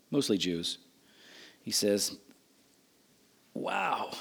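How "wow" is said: hushed, awed wow; softly acted amazement; marveling. Jesus reacting to the centurion's message, quietly marveling. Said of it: "that's what he's marveling".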